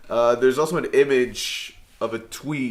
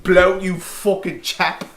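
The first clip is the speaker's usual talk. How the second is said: slow put-on Cockney insult; hammed-up Cockney accent; mock Cockney; 5 semitones higher, slower than usual. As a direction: sneer it like a fake Londoner. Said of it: "Cockney person".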